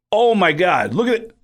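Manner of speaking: high, loud thrilled gasp; excited spotting. Retold or imitated. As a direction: point out a celebrity with a rush. Spots [name] arriving.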